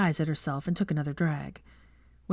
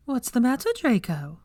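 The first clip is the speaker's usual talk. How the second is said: syrupy and false, like a nasty teacher; falsely sweet Umbridge; 7 semitones higher than usual. Acted. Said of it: "falsely sweet tone reminiscent of Dolores Umbridge".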